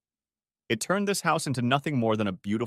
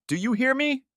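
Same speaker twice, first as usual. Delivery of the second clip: a pitched-up mock growl, lightly voiced. A narrator's reading. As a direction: snarl the question at him. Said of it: "she growled at me".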